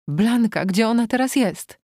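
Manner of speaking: high, anxious demand; agitated. A narrator's reading. Distraught wife asking her husband about Blanka, agitated.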